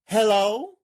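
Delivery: a high, loud, rising hello into the phone. Acted. Marcus answers the phone.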